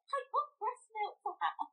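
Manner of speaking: high-pitched, triumphant call without extra volume; retold excited announcement. Mother reliving running to the nurses with milk, excited. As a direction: proudly announce the news in a high voice.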